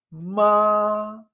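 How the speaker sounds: "Ma" high, loud and held level; first tone. Shown as a demonstration. Teacher asks learner to repeat, "Ma" sustained high and level. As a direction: hold "Ma" steady on a high pitch. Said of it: "es como un tono agudo".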